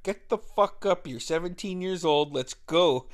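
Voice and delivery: imitating a pumped-up brother, punchy and forceful; rousing pep talk. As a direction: fire up your brother to head out. Brother rallying him for booze hunt.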